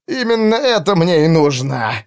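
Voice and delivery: hoarse rasp; wounded soldier. Acted. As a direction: wheeze it out. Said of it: "прохрипел солдат". (хрип раненого солдата)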